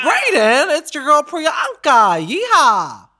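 high, loud hyped welcome; excited greeting. Real, not acted. Host greeting the Texan caller with a loud high yeehaw. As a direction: greet him like a rodeo cheer.

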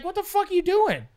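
high, disbelieving scold; exasperated. Retold or imitated. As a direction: throw up your hands vocally. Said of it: "it's just like".

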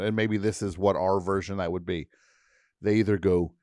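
normal talking voice

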